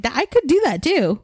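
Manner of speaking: loud, high retold comeback; angry. Daughter retelling her comeback to dad, angry and loud. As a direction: fire back in exasperation. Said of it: "so you can see that I'm angry".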